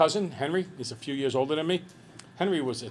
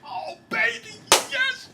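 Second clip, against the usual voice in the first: much higher squeal of joy; thrilled squeal; 3 dB louder, slower than usual. Retold or imitated. Aunt seeing the huge gift box, in a much higher, thrilled squeal. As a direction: squeal like you just won big. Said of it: "you know how excited you get".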